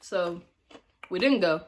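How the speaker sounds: ordinary talk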